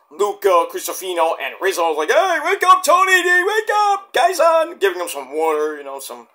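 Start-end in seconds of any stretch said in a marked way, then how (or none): 2.1-4.8 calling out to wake him. Retold or imitated. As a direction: shout him awake, pitched way up. Rizzo rousing the dazed Tony D.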